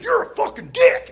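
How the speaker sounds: high, loud, strained outburst; high-pitched mock fury; angry kid brother. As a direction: yell it as a furious little brother. Gasping brother snaps back, acted.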